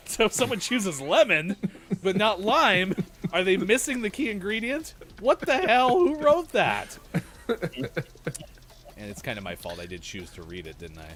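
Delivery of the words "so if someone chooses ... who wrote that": exasperated. Real, not acted. Mock outrage at the calendar.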